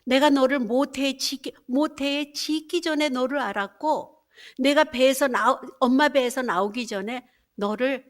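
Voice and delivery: loud, ringing scripture reading; declaiming Bible verses from the pulpit; preaching scripture. Acted. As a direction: proclaim God's word to the congregation.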